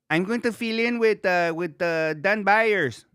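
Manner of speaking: high-pitched impression of a radio host; Monty. Imitating a co-host announcing a fill-in, high impression. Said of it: "love your, or your Monty voice".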